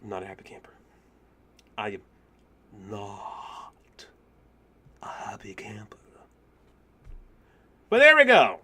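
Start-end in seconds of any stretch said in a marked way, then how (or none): 0.0-0.7 very soft, low, whispered grumble; deflated whisper. Real, not acted. Gamer after losing the run, deflated whisper. 1.8-6.0 whispered word by word, with long pauses; slow deflated whisper. Real, not acted. Gamer after losing the run, slow halting whisper.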